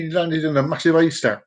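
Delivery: usual speaking voice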